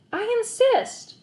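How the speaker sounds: put-on high, slow insistent boy; insistent kid. A narrator's reading.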